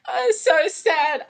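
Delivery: ordinary delivery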